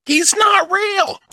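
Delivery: slow, shrieking high retort; shrill overlapping reality fight. Retold or imitated. Voiced reply in the fight.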